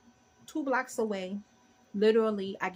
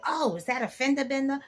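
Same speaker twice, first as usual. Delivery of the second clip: higher, louder nosy bystander voice; mock a meddling passerby; 6 semitones higher, 5 dB louder than usual. Nosy man leaving the store, mocking his curiosity. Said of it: "he was not minding his business".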